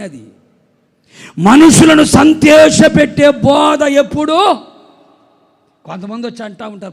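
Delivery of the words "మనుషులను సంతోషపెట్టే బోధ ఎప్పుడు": slow, raised, shouted proclamation; full-force preaching. Real, not acted. Preacher refusing crowd-pleasing sermons, slow thundering shout. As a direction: hurl it out long and loud.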